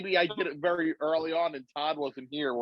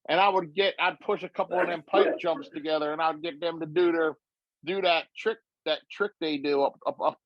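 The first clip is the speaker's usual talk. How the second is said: hammed-up Southern accent; impersonated accent; 3 semitones higher than usual. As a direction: impersonate a folksy Southern talker planning tricks. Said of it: "say pipe jump in that accent".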